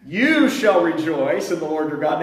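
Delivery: ordinary delivery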